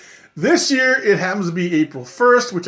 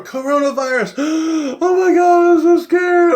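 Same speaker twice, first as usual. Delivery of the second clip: high, loud, hammed-up gasping terror; mock panic; 10 semitones higher, 5 dB louder than usual. Mocking viewers who fear the virus, hammed-up terror. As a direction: sneer at the panic with fake fright.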